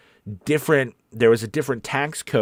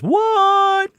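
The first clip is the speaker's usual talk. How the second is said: long, high, loud mock-shocked what; exclaimed "what"; 6 dB louder than usual. Host reading a viewer's comment aloud, exclaimed.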